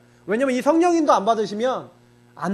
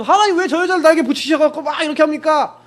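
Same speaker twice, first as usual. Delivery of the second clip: higher, louder mock complaint to God; irritated complaint; 7 semitones higher, 6 dB louder than usual. Pastor reenacting his complaint to God about his wife, irritated. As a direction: whine it like a fed-up husband. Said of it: "신경질을 딱 내니까".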